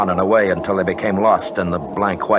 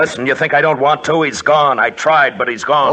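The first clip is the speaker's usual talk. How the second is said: raised, louder voice, cracking with grief; anguished outburst; 7 semitones higher, 5 dB louder than usual. Acted. Leader snaps back at his teammate over the dead friend: anguished, louder outburst. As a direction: shut the argument down in pain.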